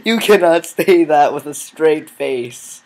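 loud, drawn-out, laughing protest; laughing disbelief. Real, not acted. Player to noob friend claiming to be pro, laughing disbelief. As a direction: protest slowly and loudly through laughter.

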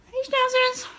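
high little pet-greeting voice; cooing to pets. Real, not acted. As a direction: greet the dogs in a high coo. Greeting her dogs fondly.

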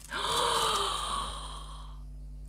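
long high breathy gasp of wonder. Real, not acted. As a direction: suck in air in awe. Opening the baby box.

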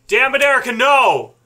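high, loud frustrated yell; exasperated yell. Real, not acted. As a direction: yell at her to stop. Yelling at partner's choice.

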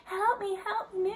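high, soft cry for help; mild mock scream. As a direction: imitate a distant plea, kept small. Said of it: "she was screaming".

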